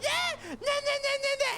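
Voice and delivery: high singsong nah-nahs; mocking singsong taunt. Imitating co-host's taunting in a high singsong. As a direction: taunt like a kid. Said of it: "And I go".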